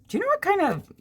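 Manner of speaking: high, bright question; curious question. Retold or imitated. Asking sister about the bush, high curious question. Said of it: "I said".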